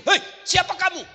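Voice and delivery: mock husband startled at the well; startled shout. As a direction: jump in fright and call out. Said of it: "Kaget suami".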